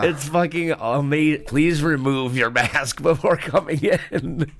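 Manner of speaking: laughing repeat of the sign's words. Retold or imitated.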